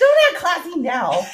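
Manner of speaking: slow, high, laughing tease; teasing. Real, not acted. Teasing her friend over cheap wine, slow and laughing. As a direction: drag it out to mock her. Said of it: "teasing her friend".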